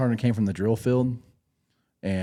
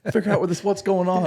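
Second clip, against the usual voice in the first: brisk staff sergeant; 10 semitones higher, 4 dB louder than usual. Retold or imitated. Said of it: "He's like".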